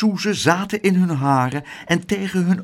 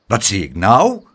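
raised indignant question, rising at the end; indignant cry; 5 semitones lower, 4 dB louder than usual. A narrator's reading. Woman spotting an unwanted child, indignant cry. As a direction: call it out in disbelief. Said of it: "riep ze".

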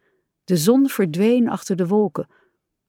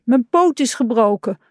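high plaintive complaint; pained little whine; wailing; 5 semitones higher, 3 dB louder than usual. A narrator's reading. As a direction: moan about the injury, pitch up. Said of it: "jammerde hij".

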